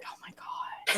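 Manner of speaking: breathless horrified whisper. Retold or imitated. Grandpa whispers in horror at the cafeteria table, breathless and shocked. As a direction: gasp it out under your breath in shock. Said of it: "He was horrified".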